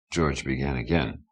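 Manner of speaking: ordinary delivery, nothing marked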